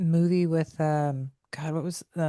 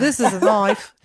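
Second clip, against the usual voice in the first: louder mock Australian film-line accent; Australian accent attempt; 3 semitones higher, 5 dB louder than usual. Shown as a demonstration. Showing off to co-hosts, hammed-up Australian accent. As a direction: show off your broad Australian accent. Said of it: "That's pretty good".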